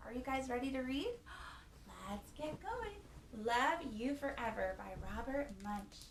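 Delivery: ordinary delivery, nothing marked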